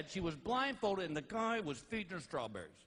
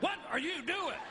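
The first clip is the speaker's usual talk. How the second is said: higher, indignant woman's question; baffled wife catching him tugging underwear; mock annoyed woman; 9 semitones higher than usual. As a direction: play the wife who isn't impressed. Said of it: "the women are like".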